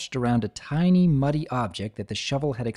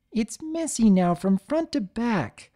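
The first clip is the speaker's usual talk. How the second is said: sulky; 10 semitones higher than usual. Acted. Sweetie sulks.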